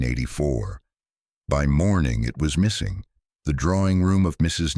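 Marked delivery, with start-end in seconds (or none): none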